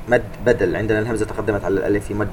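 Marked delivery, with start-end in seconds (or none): none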